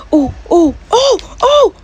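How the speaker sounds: high, loud excited oh-ohs; rising thrilled yelps. Real, not acted. Reaction to a big roll.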